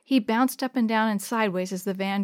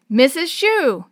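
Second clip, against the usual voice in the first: loud, raised-pitch punchline; gleeful yell; 5 semitones higher, 8 dB louder, slower than usual. A narrator's reading. Chris and Willie finishing the teacher's introduction, yelling. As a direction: yell out the name as a joke. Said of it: "Chris and Willie yelled".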